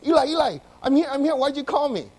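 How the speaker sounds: eager high-pitched call; young Samuel calling out. Acted. Acts Samuel running back to Eli.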